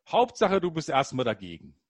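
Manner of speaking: Ehefrau lauter nachgemacht, neckend; leicht gespielter Seitenhieb der Ehefrau; zitierte Ehefrau. Retold or imitated. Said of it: "meine Frau hat ein schönes Wort". (er zitiert die Ehefrau über sein Dagegensein, neckend laut)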